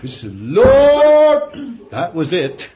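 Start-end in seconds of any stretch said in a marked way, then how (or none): 0.3-1.9 loud, high cry of Lord; a prophet's full-voiced cry at the altar; calling out. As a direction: cry out to heaven with all your might. Elijah calling on God.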